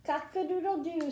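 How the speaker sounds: high storybook rooster crow. A narrator's reading. Story reader voices the rooster for children, crowing. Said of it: "said the rooster".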